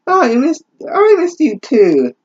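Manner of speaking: squeaky high mock lover; hammed-up high-pitched boyfriend; high silly voice. Voicing Denmark high.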